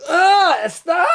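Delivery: high shouted plea to stop; terrified plea. Retold or imitated. Kid begging the bully to stop, terrified shout. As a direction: beg him in panic. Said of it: "wanna see terror on me".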